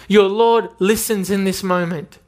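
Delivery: usual speaking voice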